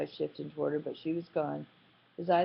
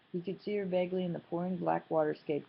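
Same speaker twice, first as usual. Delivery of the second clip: ordinary delivery, nothing marked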